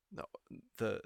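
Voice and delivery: usual speaking voice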